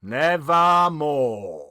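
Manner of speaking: low, slow raven voice; slow otherworldly raven voice; raven's reply. A narrator's reading. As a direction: draw the raven's word out, heavy and cold. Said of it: "Quoth the raven".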